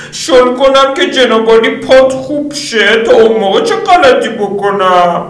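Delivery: high, slow sarcastic retort; high female voice needling; Lydia, sarcastic. Acted. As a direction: throw his words back at him.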